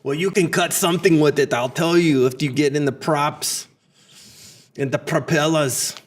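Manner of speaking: put-on thick Austrian action-hero accent; Austrian-accented action star impression; Arnold. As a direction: play an Austrian movie tough guy. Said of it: "If you cannot handle Arnold".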